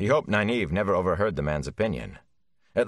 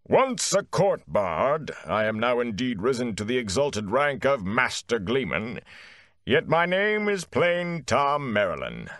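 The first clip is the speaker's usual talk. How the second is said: booming bard; 6 semitones higher than usual. A narrator's reading. A court bard proclaims his rank to villagers, booming. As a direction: fill a great hall with your voice. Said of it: "voice seemed to reverberate in a great hall".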